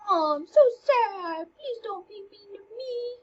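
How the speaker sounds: put-on high, whiny little-girl pleading; mock pleading. Teacher mimicking feminine wiles, mock pleading.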